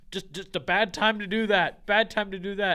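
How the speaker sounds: loud repeated scolding; mock annoyed dad. Father repeats his scolding to son, loud and annoyed. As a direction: hammer the point home, irritated.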